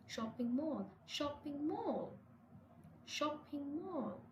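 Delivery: "shopping" stressed, "mall" falling away; stress on first word. Shown as a demonstration. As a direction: lean on "shopping" in "shopping mall", slowly and deliberately. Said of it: "we stress the first word".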